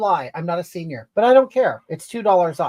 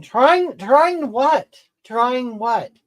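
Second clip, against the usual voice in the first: high, louder, indignant comeback; indignant retort; 5 semitones higher, 5 dB louder, much slower than usual. Real, not acted. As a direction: throw it back, pitched up and affronted. Bristles at a viewer's comment.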